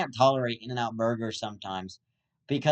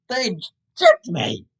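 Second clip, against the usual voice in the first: slow loud outraged cry; mock outrage; 7 semitones higher, 9 dB louder, slower than usual. Podcaster complains about overcharge, mock outrage. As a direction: cry out betrayed.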